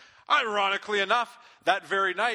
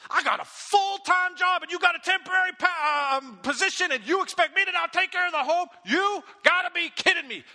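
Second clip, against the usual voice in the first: high and loud, a toned-down rant; retold angry shout, much higher and louder; mock angry outburst; 10 semitones higher, 3 dB louder than usual. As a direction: act out the fight, but not at full heat. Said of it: "way meaner than the way I just said".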